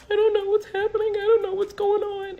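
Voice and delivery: high, loud mock-tearful wail; imitating a panicked, weepy plea. Crying passenger pleading ignorance to the police officer, tearful. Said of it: "I got tears in my eyes".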